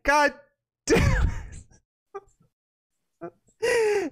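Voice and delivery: high, drawn-out yell; hammed-up rage shout. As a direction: scream it like a chef losing it.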